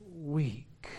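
ordinary talk, nothing marked

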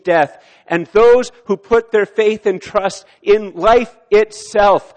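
loud, high, slow preacher's climax; preaching at full force. Acted. Pastor proclaiming victory over death, loud and high. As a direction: proclaim it like a triumphant shout.

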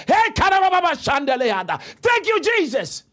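high burst of fervent tongues and thanks; ecstatic praise. Real, not acted. Preacher prophesying over somebody, ecstatic cry.

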